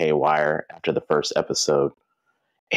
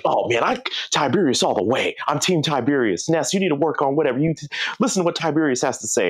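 eager fans; 9 semitones higher, faster than usual. Retold or imitated. Fans siding with Tiberius.

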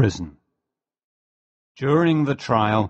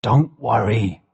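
excitedly. Acted. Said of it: "I said excitedly".

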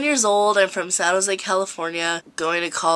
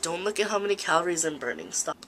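soft, fast annoyed mutter; muttered aside; 2 semitones lower, 8 dB quieter, faster than usual. Retold or imitated. At the gym, quietly annoyed at the girl. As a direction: hiss it under your breath at the gym girl. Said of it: "I'm like".

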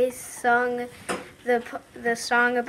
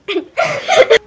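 loud giggle; laughing; 12 semitones higher, 12 dB louder than usual. Real, not acted. Laugh after song.

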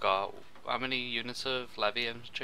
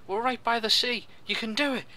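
high, loud encouragement; excited cheering; 13 semitones higher, 15 dB louder than usual. Real, not acted. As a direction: cheer them on loudly. Cheering on naval bombardment.

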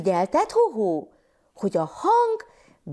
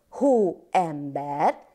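hó-em-ber said slowly, syllable by syllable; split into syllables; 4 semitones lower, much slower than usual. Shown as a demonstration. Teacher to children, clapping out syllables slowly. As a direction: stretch Hóember out into its three syllables. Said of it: "szótagoljunk".

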